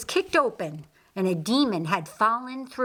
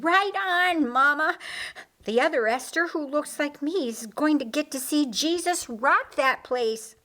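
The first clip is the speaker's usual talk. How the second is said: enthusiastic; 6 semitones higher than usual. Acted. Esther eagerly agrees with her mother, enthusiastic and high. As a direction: cheer it out like an eager girl. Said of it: "Esther enthusiastically agreed".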